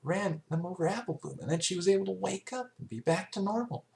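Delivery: high, light filly voice telling a story; young filly voice. Acted. Filly explains what she saw, in a high young voice. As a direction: play the excited little pony describing it. Character voice in fanfic reading.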